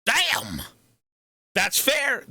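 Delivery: high-pitched amazed outburst; excited exclamation. Real, not acted. Streamer seeing a viewer's big win, high excited outburst. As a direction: let it leap up high in amazement.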